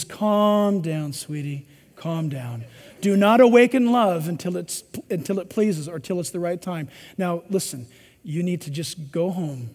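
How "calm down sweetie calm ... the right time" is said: much lower and softer, tender hush; gentle calming. Retold or imitated. Solomon calming his excited bride, low tender hush. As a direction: soothe her down like a lover. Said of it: "He says".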